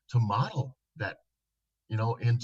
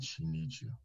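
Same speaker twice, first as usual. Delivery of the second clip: much softer and lower, gentle; 7 semitones lower, 12 dB quieter than usual. Retold or imitated. Therapist softly telling a shut-down husband, low and gentle. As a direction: say it softly to get through to him. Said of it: "And my voice lowered and softly said".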